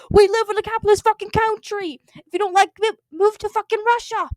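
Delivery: high, loud screeching rant. Acted. Paul screeching at John to move to Russia. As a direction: screech it at him in fury. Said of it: "He screeched".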